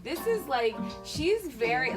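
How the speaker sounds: normal talking voice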